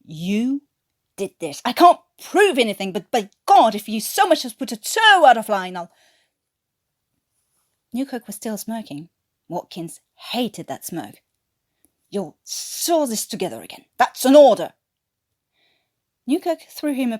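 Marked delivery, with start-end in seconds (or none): 0.0-5.9 loud, barely held fury; furious. A narrator's reading. Officer threatening a smirking prisoner, furious. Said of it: "fury burning in his eyes". 12.1-14.7 curt command, last words loud; angry order. A narrator's reading. Flight sergeant ordering the corporal, angry.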